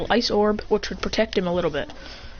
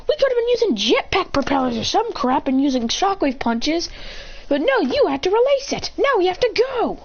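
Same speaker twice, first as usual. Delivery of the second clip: high, acted angry yelling; heated retort; 13 semitones higher than usual. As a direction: argue back in a high, raised voice.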